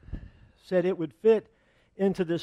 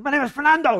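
high, loud hammed-up scream; mock scream; 10 semitones higher, 8 dB louder, faster than usual. Trapped bullfighter screaming from the burning roof, hammed-up loud. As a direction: scream your name back down in defiance. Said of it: "And he screams out again".

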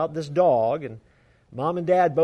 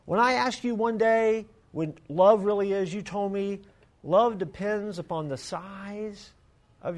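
put-on high, slow, earnest child; a girl explaining love to her mother in a high voice; little girl voice; 6 semitones higher, slower than usual. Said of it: "She said".

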